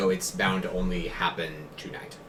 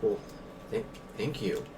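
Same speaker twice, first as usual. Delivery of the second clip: soft, stammered thanks; astonished; 9 dB quieter than usual. Acted. Shopkeeper unused to kindness thanking a centaur, astonished and hesitant. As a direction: stumble quietly into gratitude. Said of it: "astonished that someone even gives him the time".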